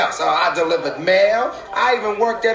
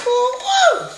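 excited whoop. Acted. Martin celebrating.

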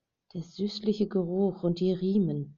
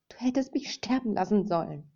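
higher, louder angry reproach; angry outburst; 4 semitones higher, 4 dB louder than usual. A narrator's reading. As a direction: hurl the blame at him. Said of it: "rief sie aufgebracht". (aufgebrachter, vorwurfsvoller Ausruf)

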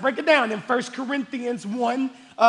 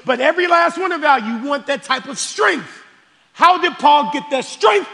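raised, driving preacher's voice; fervent preaching; 6 semitones higher, 6 dB louder than usual. Real, not acted. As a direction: preach it hard, piling up each phrase. Preaches at full force.

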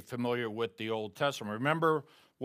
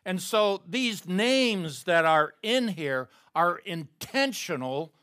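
usual speaking voice

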